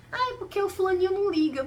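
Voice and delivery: high, loud whiny excuse; mocking students. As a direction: mimic teens shrugging it off.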